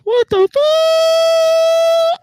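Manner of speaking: very high, very slow, loud stretched-out yell; exasperated scream. Real, not acted. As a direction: scream in total disbelief. Mic volume fails again.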